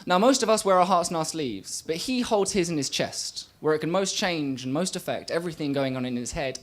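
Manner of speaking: measured, rhythmic poem delivery; spoken word poetry. Acted. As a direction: perform it as a slam poem.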